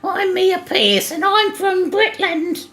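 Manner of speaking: squeaky mock Brit rattling off pub food; British voice. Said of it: "you can still do the British voice".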